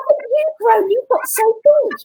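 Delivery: put-on adult cooing at a child's friends, high and sing-song; patronising sing-song. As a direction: talk down to them like a pet. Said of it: "whenever we take on that intonation".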